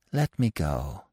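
softly pleading fox, weak and despondent; soft, weak plea; quiet, hopeless begging; soft, beseeching. A narrator's reading. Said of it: "He spoke softly and beseechingly".

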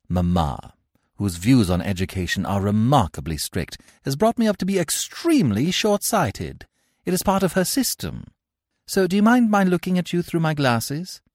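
a hint of a gushing Victorian lady; lightly voiced haughty lady. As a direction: play a grand lady being charming. Gwendolen voiced in read play.

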